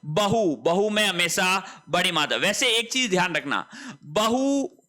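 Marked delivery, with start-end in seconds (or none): 4.0-4.8 बहू with long final "oo", ba-hoo; long final vowel. Shown as a demonstration. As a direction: stretch the end of बहू. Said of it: "दोनों ही सही है". (बहू का आख़िरी ऊ लंबा)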